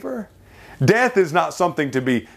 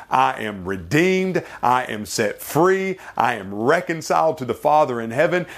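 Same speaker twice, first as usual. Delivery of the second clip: usual speaking voice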